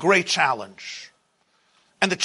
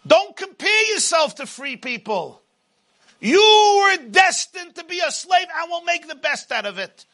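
booming motivational speech; 4 semitones higher than usual. Acted. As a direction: preach it as the taunting tyrant. Pharaoh voice held, preaching.

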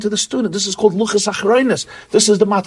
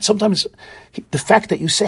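ordinary talk, nothing marked

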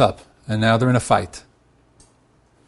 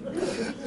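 short breathy laugh; 13 dB quieter than usual. Real, not acted. Speaker laughs at listener's joke.

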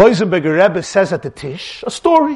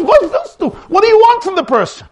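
high-pitched, insistent protest; rabbi's exasperated comeback, pitched high; exasperated retort; 12 semitones higher, 4 dB louder than usual. Acted. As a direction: argue back in a raised pitch. Voicing a rabbi's indignant retort.

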